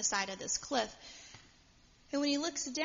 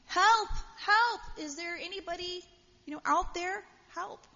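high-pitched cry for help, loud at first; yelling for help; 8 semitones higher, 5 dB louder than usual. Acted. Jack dangles from a cliff branch, yelling for help. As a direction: call out high for anybody to hear. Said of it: "he began yelling for help".

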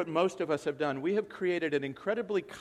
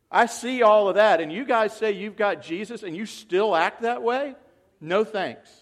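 scornful outsider; 3 semitones higher, 7 dB louder than usual. Retold or imitated. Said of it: "the world's looking and going".

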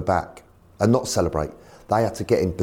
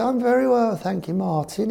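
posh chairman. Retold or imitated. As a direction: put on a plummy chairman voice. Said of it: "He's very posh".

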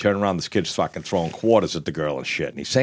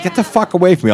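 angry retort; 10 semitones higher, 6 dB louder, faster than usual. Retold or imitated. Telling bleeding kid off.

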